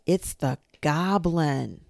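loud, drawn-out cry of alarm; slow, loud storybook shriek of alarm. A narrator's reading. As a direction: shout the warning, stretching the last word. Child cries out in alarm.

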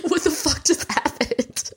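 high, shocked mock panic; retold terrified. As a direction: squeak it out like you're cornered. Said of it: "terrified in a corner".

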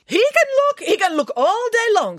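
very high, loud indignant director; squawking, theatrical outrage; exasperated outburst. Acted. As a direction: burst out in exasperated protest.

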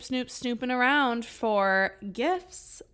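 slow, drawn-out sneaky words; stretched out. Retold or imitated. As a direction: linger on each snoop, slowly. Drawn-out sneaky snooping again.

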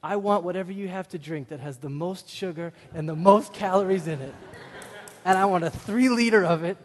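loud, rising, defiant order; rally the clerk to the cause; cheeky zealous shout to a store clerk; fired-up demand. Retold or imitated. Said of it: "I said to the clerk".

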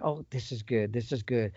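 low, soft self-talk; quiet aside to self. Retold or imitated. Hunter to himself as the bull nears, quietly pleased. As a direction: murmur it low to yourself. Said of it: "So I'm like".

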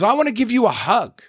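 a hammed-up gushing woman; mock gushing fan. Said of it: "throws her arms up and says".

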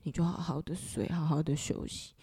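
soft whisper into a dying father's ear; whispering in his ear. Retold or imitated. Whispering to her sleeping father in hospital, soft whisper. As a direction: breathe it gently, close to his ear. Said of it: "过去我爸耳边跟我爸爸说".

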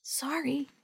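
soft apologetic whisper; hushed, barely there. A narrator's reading. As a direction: whisper an apology after being shushed. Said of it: "Dee Dee whispered".

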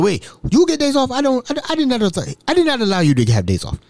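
high-pitched mock outrage; kidding indignation. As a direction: imitate a man jokingly scandalized. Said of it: "He's kidding, of course".

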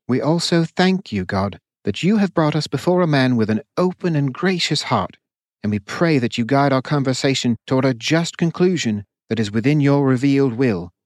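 reverent grace before a meal; solemn meal blessing. Acted. Curian adds to prayer.

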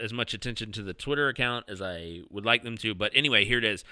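usual speaking voice

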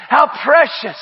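normal talking voice

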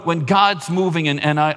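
normal talking voice

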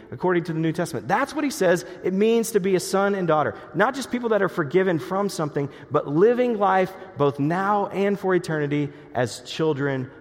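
high, loud, soaring preacher's climax; rising revival preacher voice; full-force preaching. Acted. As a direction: lift the congregation toward eternity.

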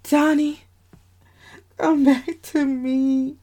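ordinary delivery, nothing marked

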